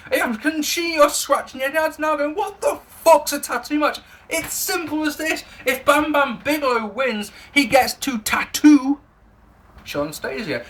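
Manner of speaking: high, put-on explaining voice; exaggerated high-voiced disbelief; mock incredulity. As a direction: pitch it way up as if baffled by the absurdity. Mock-incredulous explanation of absurd match.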